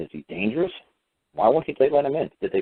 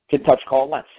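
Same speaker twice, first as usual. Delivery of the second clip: ordinary talk, nothing marked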